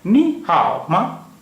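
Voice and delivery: नी हाओ माँ slowly, with exaggerated Mandarin tones; Mandarin model pronunciation. Shown as a demonstration. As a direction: model नी हाओ माँ word by word, high नी and dipping हाओ. Teacher models Mandarin question slowly.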